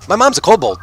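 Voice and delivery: put-on the small gnome kid chirps proudly about his mom; high kid voice. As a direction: say it like a cheerful little kid. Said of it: "Ooh goes".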